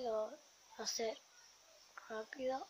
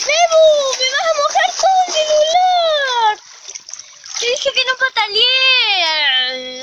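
high, slow furious shouting; angry yell; 11 semitones higher, 13 dB louder, slower than usual. Real, not acted. Scolding kid for splashing again.

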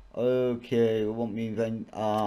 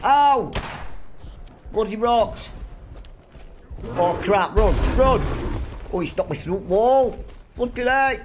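panicked yelling; 10 semitones higher, 6 dB louder than usual. Real, not acted. Knocked through wall by beast.

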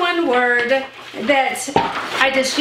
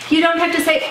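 usual speaking voice

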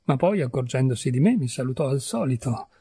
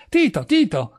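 loud high call across the crowd; calling loudly; 9 semitones higher, 4 dB louder than usual. A narrator's reading. Renzi pushes through the crowd calling his friend loudly. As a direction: call out to him over the crowd. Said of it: "chiamando forte".

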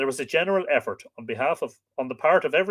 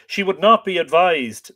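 louder, higher lament; wailing servant; 6 semitones higher, 8 dB louder than usual. Acted. Servant laments to Fionn about his lady, wailing. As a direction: cry it out in grief. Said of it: "the servant wailed".

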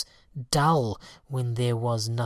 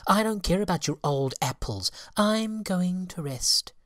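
slightly high, lazy refusal; sulky fairy; 7 semitones higher than usual. Acted. Fairy brushing off the birds, a touch sulky. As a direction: shrug them off.

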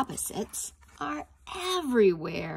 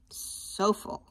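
whispered aside. Shown as a demonstration. Teacher whispers to children about the full basket.

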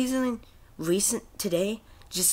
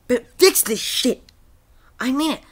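loud, high angry shout at EA; angry outburst; 8 semitones higher, 10 dB louder than usual. Real, not acted. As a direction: yell it, fed up and demanding. Angry rant at EA.

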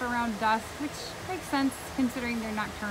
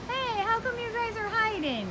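high-pitched, sing-out call to pet birds; cooing to birds; 10 semitones higher than usual. Real, not acted. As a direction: coax the shy birds in a high voice. Playfully calling to the macaws.

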